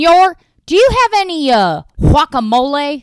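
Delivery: loud, high dad ordering with overdone Spanish; mock Spanish-accented order, belted out; dad impression. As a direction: ham up a tourist dad at a restaurant. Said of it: "my dad at a Me-, Mexican restaurant".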